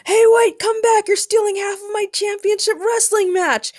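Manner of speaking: very high, loud yell; shouting after them. A narrator's reading. Ray chases his kidnapped opponent, shouting. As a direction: yell desperately after someone getting away. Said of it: "Ray shouted as he chased".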